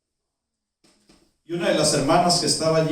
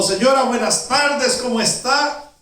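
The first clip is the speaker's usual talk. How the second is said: loud, high greeting, drawn out with excitement; saludo emocionado; 8 semitones higher, 6 dB louder, slower than usual. Acted. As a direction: greet her brightly, thrilled to meet her. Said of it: "Estaba tan contenta, tan emocionada". (saludo alto y fuerte, muy emocionado)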